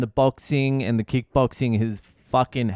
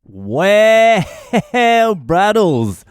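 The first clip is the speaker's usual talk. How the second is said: high, loud drawn-out showman greeting; big mock greeting; 8 semitones higher, 8 dB louder, much slower than usual. Host greeting the caller on the show, loud stretched greeting.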